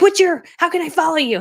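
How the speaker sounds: loud, high mock eagerness; imitated eager kids. Kids ask how to follow her, imitated eagerness. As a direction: blurt it like an excited young fan.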